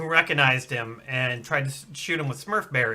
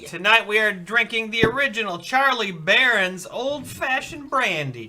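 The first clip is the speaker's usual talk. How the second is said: announcer voice; 6 semitones higher, 6 dB louder than usual. Acted. Announcer intro.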